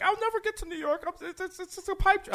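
very high, small, soft tearful imitation; mock crying girl. Storyteller imitating a crying girl who longs for New York, mock tearful. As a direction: squeak it out softly like a girl in tears. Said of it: "some girl started crying in the club".

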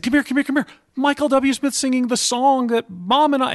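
high, loud eager calling out; excited yell to the kids. Retold or imitated. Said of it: "I actually yelled to my children".

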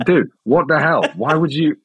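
high, loud mock outrage; retelling the friend's hoax with a shocked yell; outraged disbelief. Retold outrage at the prank.

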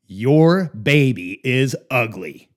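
big, slow, loud pronouncement; Randy. Retold or imitated. Client bluntly judging the visitor's products, slow loud mock verdict. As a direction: imitate a big-voiced man, each word drawn out and loud. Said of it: "He had a big voice, big Southern voice".

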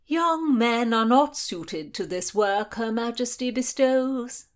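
high, loud lament. A narrator's reading. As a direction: bemoan it theatrically. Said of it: "Henry Lee lamented to the Marquis".

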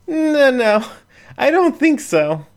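a blushing raccoon waving it off; squeaky flustered no. A narrator's reading. Jen refuses, flustered.

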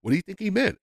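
high, questioning mother voice; imitating his mother. Mother on the phone asking what Ali meant, in a high voice. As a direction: pitch it up high like Mom asking. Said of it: "and she goes".

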